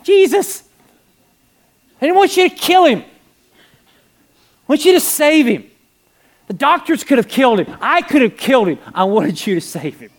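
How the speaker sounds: high, loud shout of protest; a father crying out in anguish, easing at the end; anguished outcry. Retold or imitated.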